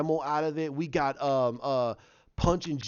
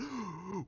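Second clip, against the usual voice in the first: gasp; 3 semitones higher, 12 dB quieter than usual. Retold or imitated.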